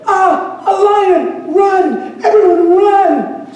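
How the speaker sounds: high-pitched cry of alarm with drawn-out words; hammed-up, high squeal of panic; panicked yelling. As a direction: sheep yelling for everyone to flee. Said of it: "They start yelling".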